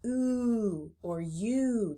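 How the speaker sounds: "oo or you" drawn out very slowly; long U sound. Shown as a demonstration. Teacher shows the long U sounds, slowly. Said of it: "Its long sound is".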